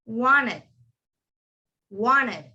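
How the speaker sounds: "wanted" said as "wanned"; silent T. Shown as a demonstration. Teacher models wanted for the class, silent T. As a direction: drop the T after N in "wanted". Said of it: "Can everyone say that?".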